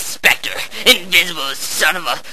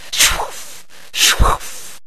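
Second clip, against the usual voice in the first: sound; 5 dB quieter than usual. Acted. Imitating chainsaw sounds.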